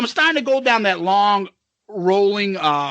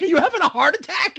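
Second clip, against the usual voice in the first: higher, quicker mimicked question; imitated alarmed daughter; 10 semitones higher, faster than usual. Daughter asks dad in the ride, imitated alarm. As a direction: voice a worried daughter, pitched up and hurried.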